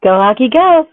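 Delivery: loud high cheer of the team chant. Real, not acted. Guest greeting hosts with a loud fan cheer.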